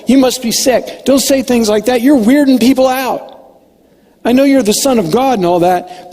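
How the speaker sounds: a disciple lecturing his teacher, exasperated; Peter scolding Jesus. Retold or imitated. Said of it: "Peter said something to the effect of".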